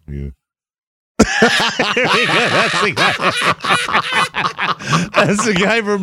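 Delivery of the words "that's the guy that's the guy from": laughing through the words; can't stop laughing at the picture. Real, not acted. Laughing at the photo.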